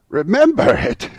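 high, loud fervent cry. A narrator's reading. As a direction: cry it out with fervour. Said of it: "cried Scrooge with fervour".

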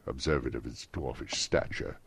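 ordinary delivery, nothing marked

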